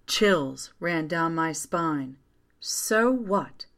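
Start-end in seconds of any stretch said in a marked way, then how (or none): 2.6-3.7 lightly voiced mother, pitch raised; remembered mother. A narrator's reading. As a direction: echo a mother's dismissal from memory. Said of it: "my mother had said all those years ago".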